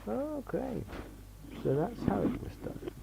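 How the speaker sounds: high, soft dawning realisation; a quiet penny-drop of discovery; realising. Retold or imitated. Reader finally getting how it was done, surprised. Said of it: "and think".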